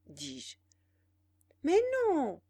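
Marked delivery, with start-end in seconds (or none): 1.6-2.4 amused, talking down; airy, superior amusement; condescending and amused. A narrator's reading. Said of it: "d'un air condescendant et égayé".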